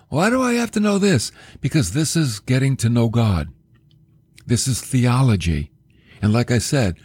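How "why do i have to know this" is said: high-pitched questioning objection; doubting listener. Acted. Preacher mimics the congregation thinking, high questioning objection. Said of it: "I hope you're not thinking".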